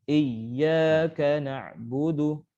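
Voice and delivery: chanted "Iyyaka" with the double y pressed hard; pushed doubled ya. Shown as a demonstration. Teacher corrects a student's verse, doubled ya pushed in chant. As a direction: chant slowly, leaning firmly on the ya in "Iyyaka". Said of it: "Dihentak lagi".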